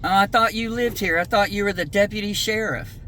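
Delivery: normal talking voice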